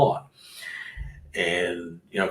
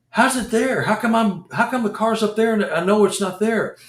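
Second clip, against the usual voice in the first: disbelieving alarm; 11 semitones higher than usual. Retold or imitated. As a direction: sound truly baffled the car moved. Freaking out about car.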